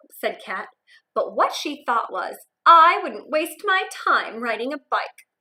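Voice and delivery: put-on lively read-aloud for young kids; expressive picture-book narration; storybook reading.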